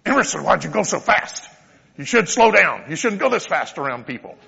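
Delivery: put-on loud, high, sharp scolding of a child; scolding parent. Parent yelling at his son after the bike crash, loud scolding. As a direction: snap at the kid without thinking. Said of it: "turn around and say".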